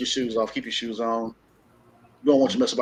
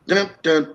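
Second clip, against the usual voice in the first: dramatic sting; 2 semitones higher, 3 dB louder than usual. Pretend. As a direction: mimic a suspense sting.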